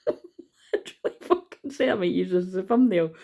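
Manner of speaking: real high, breaking-up laughter; cracking up mid-sentence; laughing hard. Real, not acted. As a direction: talk through a fit of laughter. Cracks up at the lyric.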